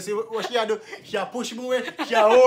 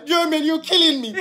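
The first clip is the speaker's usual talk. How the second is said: mocking her; 5 semitones higher than usual. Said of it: "when you say about Jermaine, you killing me".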